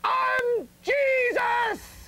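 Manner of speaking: high, slow, ringing cry of I'm Jesus; booming proclamation. Acted. Jesus proclaiming himself to the crowd, high and booming. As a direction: throw it up high and stretch every word.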